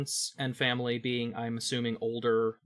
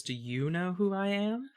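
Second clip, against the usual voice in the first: higher cartoon explorer girl voice; Dora the Explorer voice; 7 semitones higher than usual. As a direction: ask it like a cheery cartoon explorer. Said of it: "my Dora the Explorer voice".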